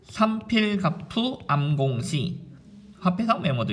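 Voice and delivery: "삼필갑푸 암공시" stretched out slowly; slow demonstration. Shown as a demonstration. Teacher introducing a mnemonic, slowly and deliberately. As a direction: draw out each syllable of "삼필갑푸 암공시". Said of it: "이 리듬을 좀 타면 됩니다".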